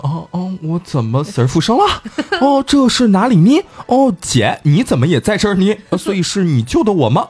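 high-pitched, mildly hammed waking surprise; acted bewilderment. Revived heroine acted waking bewildered. (装作刚苏醒、惊讶迷茫)

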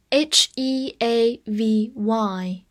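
ordinary talk, nothing marked

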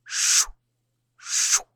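two soft, separated shushes; eerie hush from something hidden; faint ghostly shushing. A narrator's reading. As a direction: breathe out a quiet "shh", pause, repeat. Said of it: "之前那微弱的声音".

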